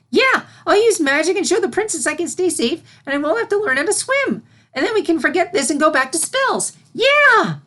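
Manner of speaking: put-on high, loud, eager young voice; excited filly. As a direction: gush out the whole plan to herself. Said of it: "the excitement of her newfound plan overtook her".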